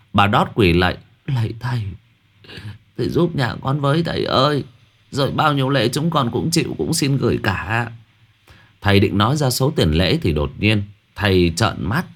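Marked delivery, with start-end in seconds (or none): 1.3-7.9 put-on higher, begging voice of a frightened old woman; pleading old woman. Bà Đót kneeling, begging the shaman, higher pleading voice. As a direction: beg the master for help.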